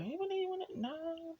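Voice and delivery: very high, very soft squeak; tiny mock voice. Speaker imitating own reaction, tiny high mock voice. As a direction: shrink it to a tiny high murmur. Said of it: "Just me just like".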